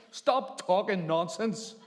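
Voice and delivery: high, loud, drawn-out telling-off; acted shouted rebuke. Scolding a stranger in a coffee shop, shouted rebuke. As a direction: bellow it slowly at the offender.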